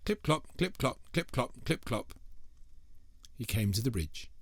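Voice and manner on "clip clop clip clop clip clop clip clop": high, light hoof sounds fading down; bouncy clip-clop. A narrator's reading. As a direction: trot it out like hooves. Third goat trots.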